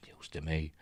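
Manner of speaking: breathy whisper under the breath; whispered surprised aside. Real, not acted. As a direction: mutter it as a private aside. Aside after reading the news.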